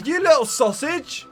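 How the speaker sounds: ordinary delivery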